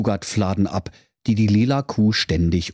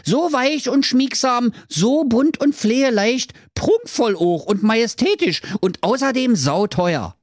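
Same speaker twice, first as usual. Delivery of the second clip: Höke's sales pitch; 14 semitones higher than usual. Acted. Höke preist Kleider an.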